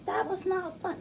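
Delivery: mock watery whine. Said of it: "be all watery and say".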